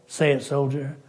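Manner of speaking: normal talking voice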